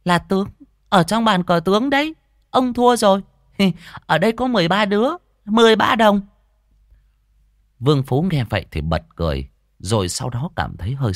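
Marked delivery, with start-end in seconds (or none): 0.0-6.2 put-on high, loud child voice; triumphant boy. Boy reveals answer and claims coins, high triumphant voice. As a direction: claim the win like a gleeful kid.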